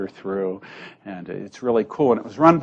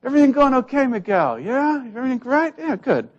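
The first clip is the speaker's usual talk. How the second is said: friendly priest; 14 semitones higher than usual. Retold or imitated. Italian priest greets staff with breezy higher cheer. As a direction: chirp it like a boss checking in on staff. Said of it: "he'd walk around and he'd say".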